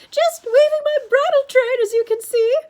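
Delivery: loud, very high falsetto. A narrator's reading. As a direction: pitch it far up in a loud falsetto. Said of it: "Grover said in his falsetto voice".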